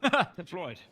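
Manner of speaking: "Floyd" said loud and firm with the r dropped; loud protest that he said "Floyd"; insisting loudly. Real, not acted. Arguing with friends about fluoride, loud insistence. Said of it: "your accent makes it sound like you're saying Floyd".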